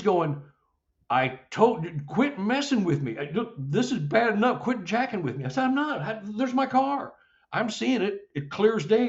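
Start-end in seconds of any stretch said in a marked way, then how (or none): 1.1-5.4 high-pitched imitation of a fed-up wife; wife's exasperated scolding. Voices freaked wife scolding him. 5.6-9.1 insistent protest. Retold or imitated. Retells himself insisting car is there.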